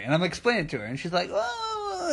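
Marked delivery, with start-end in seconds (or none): 1.3-2.1 high, soft, drawn-out whine; mock whining. Imitating the refused customer, whining. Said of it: "she's whining".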